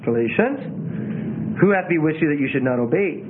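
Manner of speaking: stilted, halting Bible reading; unnatural reading. Shown as a demonstration. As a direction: put the emphasis on all the wrong words. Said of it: "you can also read this unnatural".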